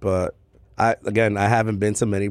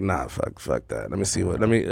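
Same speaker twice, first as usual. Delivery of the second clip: low, softer brush-off to oneself; dismissive muttering; 5 semitones lower, 5 dB quieter than usual. Acted. Drinker sizing up an idle bartender, muttered dismissal. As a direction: mutter it low, as a private thought.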